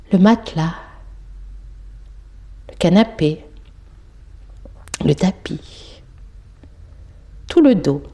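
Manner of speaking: calm meditation voice, short phrases with long pauses; measured body-scan voice, letting each phrase settle; guided yoga nidra. Real, not acted. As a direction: guide a sleeper through the body, unhurried and even.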